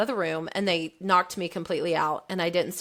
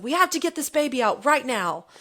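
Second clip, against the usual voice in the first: urgent raised call, pitch higher; mock doctor yelling orders, pitched up; retold yell; 6 semitones higher than usual. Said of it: "he starts yelling".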